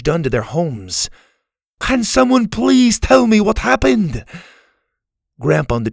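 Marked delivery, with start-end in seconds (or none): 1.8-4.2 high, loud plea; desperate high-pitched demand; distressed cry. A narrator's reading. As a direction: beg the crowd for answers, shouting. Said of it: "Scotty cried".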